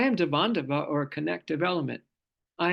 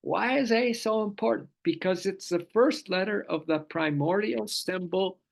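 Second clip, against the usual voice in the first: ordinary talk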